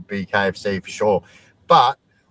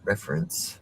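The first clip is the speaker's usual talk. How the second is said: ordinary delivery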